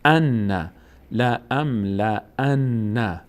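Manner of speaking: "لا ام، لا ان" sounded out slowly; syllable-by-syllable. Shown as a demonstration. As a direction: hum the m and n in "لا ام، لا ان".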